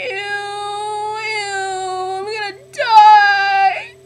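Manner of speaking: high whine building to a drawn-out wail; hammed-up disgust. Student finding the phone in a pocket, mock-horrified wail. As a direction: wail it like a drama queen.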